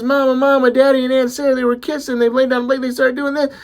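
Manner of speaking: put-on high-pitched little-boy babble; acted kid, urgent and high; excited child tattling. Little boy breathlessly tattles to mother.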